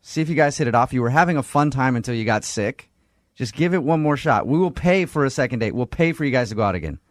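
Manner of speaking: usual speaking voice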